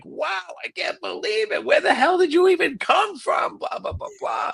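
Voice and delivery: high incredulous voice rising to a shout; can't believe your ears; mock astonished producer. [name] amazed.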